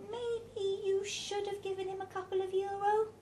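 high-pitched woman's character voice; Elsa's tentative suggestion. A narrator's reading. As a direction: float the idea in a high, careful voice. Elsa makes a tentative suggestion.

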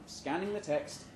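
ordinary delivery

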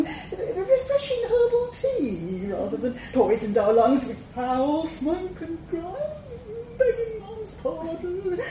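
high, faltering, cowering voice; frightened underling breaking bad news; nervous messenger. A narrator's reading. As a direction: plead in a high, anxious stammer. Cowering messenger explains ban to dragon.

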